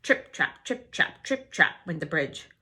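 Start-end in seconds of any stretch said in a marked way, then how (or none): none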